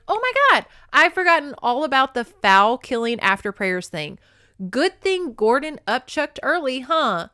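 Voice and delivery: high, loud mock-dismay; sarcastic. Woman mocks captor's superstition, loud and sarcastic. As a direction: drip with sarcasm, hand on forehead. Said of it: "her sarcastic tone".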